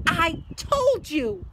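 loud, high, slow breathless cry; gasping reproach. A narrator's reading. Hermione to friends after running, loud gasping reproach. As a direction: heave out each word, out of breath. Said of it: "Hermione gasped".

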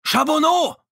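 very high, loud panicked yell of a name; panicked shout. A narrator's reading. Langevin calls to Chaboneau drifting away, very high panicked shout. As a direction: scream the name in near panic. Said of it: "schrie beinahe panisch".

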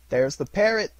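high, loud delighted shout; excited cry. A narrator's reading. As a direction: point and cry out with joy. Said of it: "cried Scrooge".

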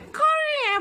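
high-pitched imitation of a child; squeaky put-on "करी है"; put-on camera voice. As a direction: mimic the kid performing for the camera in a high voice. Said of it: "ये ना कैमरे में".

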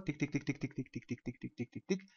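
soft, even run of spoken ticks; imitated timer ticking. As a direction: tick like a clock, quietly and steadily. Imitates timer ticking while working.

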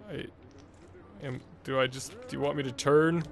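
ordinary delivery

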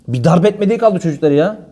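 normal talking voice

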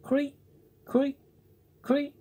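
high squeaky creak from the wall; small insect creaking high; cricket creak. Acted. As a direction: call out high and thin like a cricket. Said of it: "someone in the room saying".

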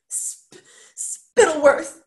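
stammered start bursting out high and loud; sobbed. A narrator's reading. Roderick naming his father's killer, stammering sob. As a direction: choke on the name, then cry it out. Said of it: "sobbed Roderick".

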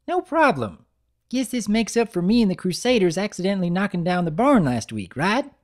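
high, quick little filly; cheeky filly. Acted. Apple Bloom joking to her brother, high quick filly voice. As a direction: rattle it off like a young filly.

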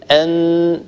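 أن with the noon held and humming through the nose; أن with a long nasal ghunna. Shown as a demonstration. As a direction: tip taps the gum, hum the n of أن. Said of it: "لاحظوا كيف".

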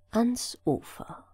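usual speaking voice

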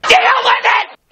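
loud high defiant yell; shout. Real, not acted. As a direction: bellow it at the viewers. Defiant outburst at viewers.

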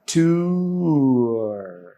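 "Tour" stretched out into a long "too-er"; very slow demo. Shown as a demonstration. Teacher slowing the word for learners, very slow. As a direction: draw out both vowel sounds in "tour". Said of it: "tempo অনেক slow করে দিলাম".

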